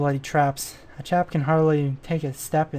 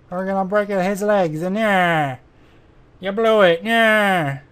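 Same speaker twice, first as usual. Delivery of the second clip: higher, drawn-out mock gangster threat; put-on tough-guy voice; 3 semitones higher than usual. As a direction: ham up a cartoon heavy, stretching "nah". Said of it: "I mean, that's like".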